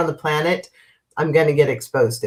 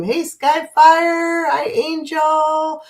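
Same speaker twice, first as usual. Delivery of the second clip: warm greeting; 11 semitones higher, much slower than usual. Real, not acted. Greeting viewers by name.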